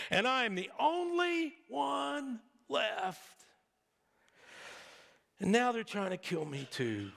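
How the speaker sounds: hammed-up whine, drawn out; whiny voice. Elijah complaining he is left alone, mock whiny voice. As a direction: wail it as exaggerated self-pity, stretching the words. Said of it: "replied in his whiny voice".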